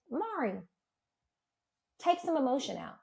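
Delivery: softly imitated calming advice; soothing mother-in-law. Mother-in-law calms her upset son's partner, imitated soft soothing. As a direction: hush an upset daughter-in-law gently.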